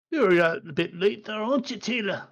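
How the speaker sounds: gruff guard calling out, pitch rising at the end; a guard's mild challenge; lightly voiced night guard. Guard challenges late visitor.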